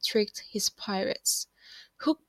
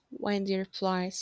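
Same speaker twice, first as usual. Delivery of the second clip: ordinary delivery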